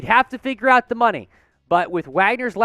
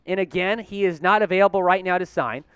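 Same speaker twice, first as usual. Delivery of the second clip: ordinary talk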